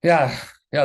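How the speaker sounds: normal talking voice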